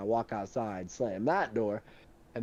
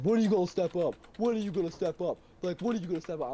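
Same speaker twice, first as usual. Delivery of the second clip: higher-pitched, pushy mock challenge; imitated confrontation; 6 semitones higher than usual. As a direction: re-enact a boss getting in your face. Drunk boss confronting him, retold.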